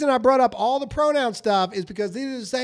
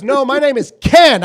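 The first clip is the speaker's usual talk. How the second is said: much louder, pressing on Ken; buddy's insistent Ken; 4 semitones higher, 10 dB louder than usual. Retold or imitated. Retelling his buddy correcting his name, louder. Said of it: "that's what I said".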